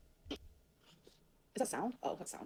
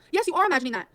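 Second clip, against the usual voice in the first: ordinary delivery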